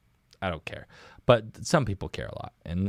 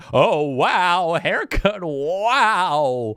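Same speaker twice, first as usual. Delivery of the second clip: high, loud mock-amazed old guy; exaggerated old-guy astonishment; 7 semitones higher, 4 dB louder, much slower than usual. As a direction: imitate an old man gawking, big swooping wows.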